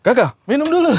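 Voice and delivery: ordinary talk, nothing marked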